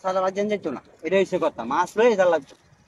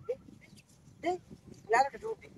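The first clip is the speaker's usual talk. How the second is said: one more tug, called out higher; urgent higher call; 11 semitones higher, 11 dB quieter than usual. Real, not acted. Angler urging the line to be tugged once more, pitched-up call.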